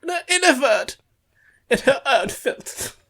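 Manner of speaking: pretend German person. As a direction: read it as a hammed-up German. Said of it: "We'll just pretend a German person sent it over".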